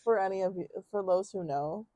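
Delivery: ordinary talk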